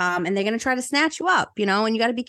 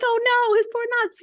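mock wail; 3 dB louder, faster than usual. Queen lamenting, crying sound.

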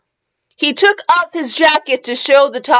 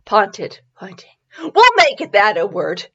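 ordinary talk

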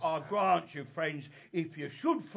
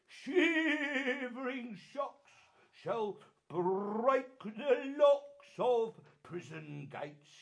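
slow, grand declaiming rant; lofty ranting tyrant; 4 semitones higher, much slower than usual. Acted. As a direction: rant like a tragic hero. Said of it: "This was lofty".

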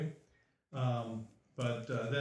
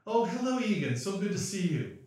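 fake welcome; 7 semitones higher, 5 dB louder than usual. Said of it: "guy behind the table say".

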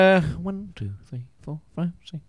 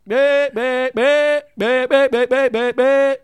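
sheep bleat; 6 semitones higher, 4 dB louder, slower than usual. Retold or imitated. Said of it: "they just go".

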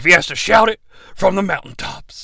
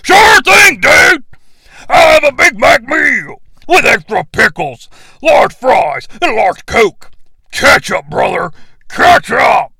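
loud, higher wrestler shout; Hulk shouting louder; 6 semitones higher, 5 dB louder than usual. Acted. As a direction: yell the order into the speaker. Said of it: "speak a little louder".

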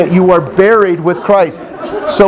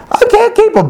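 pitched-up helpless whine; whiny sister. Pretend. A preacher voicing a sister who can't keep the commandments, high helpless whine. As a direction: play the struggling believer giving up. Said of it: "Hey, sister".

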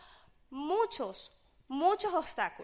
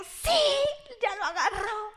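high, laughing cry of triumph; laughing glee from the floor; gleeful laughing cheer; 7 semitones higher, 4 dB louder than usual. Retold or imitated. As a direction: crow it through laughter, delighted.

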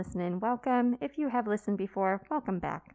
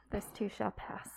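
whisper; 8 dB quieter than usual. Acted. Whispered mock-solemn aside.

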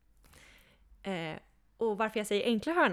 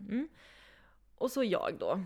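usual speaking voice

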